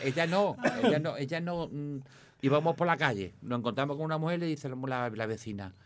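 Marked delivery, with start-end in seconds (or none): none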